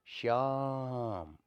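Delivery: "श्याम" drawn right out on the "आ"; extra-long vowel. Shown as a demonstration. Calling someone by name in a drama, extra-long vowel. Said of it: "इस तरीके से".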